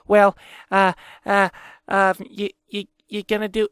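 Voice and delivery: halting, stammered mock question; exaggerated stutter. Man mimics his girlfriend asking about podcast, exaggerated stuttering. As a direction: stumble over every word, imitating her. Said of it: "she didn't stutter this much ... I'm exaggerating it".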